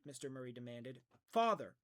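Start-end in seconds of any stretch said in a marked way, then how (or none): 1.3-1.8 loud, high protest; shocked cry. Acted. Meg shocked at her father's suspicion, crying out. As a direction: cry out at him.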